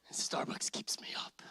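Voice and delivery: a conspiratorial whisper; whispered aside. Acted. Preacher confesses his coffee habit in a whisper. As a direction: let them in on the secret in a whisper.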